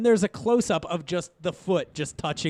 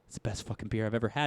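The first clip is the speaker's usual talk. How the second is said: soft, fast, dazed mock awe; softly gushing mock beer praise; dopey beer taster; 8 semitones lower, 8 dB quieter, faster than usual. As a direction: mumble it like a stoned taster. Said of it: "they're like".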